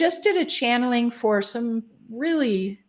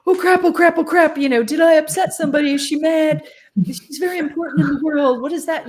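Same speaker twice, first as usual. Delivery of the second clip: higher, louder worried babble; panicky inner voice; 7 semitones higher, 4 dB louder than usual. Retold or imitated. Her small ego panicking during the channeling, high and anxious. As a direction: fret like you blew it, higher. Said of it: "five percent of me back here was like".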